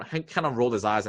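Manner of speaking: ordinary talk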